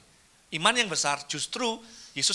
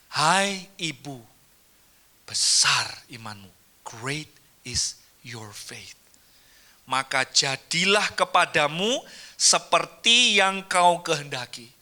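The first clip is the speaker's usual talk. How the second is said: solemn Jesus; 3 semitones lower, slower than usual. Acted. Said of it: "Yesus berkata dan menjawab".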